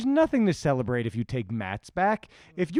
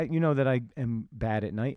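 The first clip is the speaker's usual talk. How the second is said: ordinary talk, nothing marked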